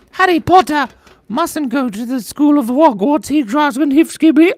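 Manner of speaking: high squeaky elf voice; Dobby voice. Retold or imitated. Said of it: "What if Dobby was like".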